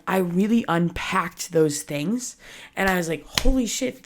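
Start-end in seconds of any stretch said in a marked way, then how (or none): 3.4-4.0 higher, sudden holy shit of realization; shocked exclamation. Retold or imitated. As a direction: blurt it out as it dawns on you. Shocked realization retold to self.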